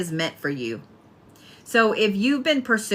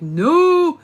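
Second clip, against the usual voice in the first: high, loud indignant no; mock loud refusal; 11 semitones higher, 8 dB louder than usual. Cancer protesting being the turtle, high and loud. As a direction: burst out in outrage. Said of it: "I know you're like".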